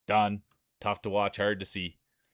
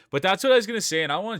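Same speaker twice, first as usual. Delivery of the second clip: excited jump; 9 semitones higher, 5 dB louder, much faster than usual. Real, not acted. Excited by Gadget Geek Girl.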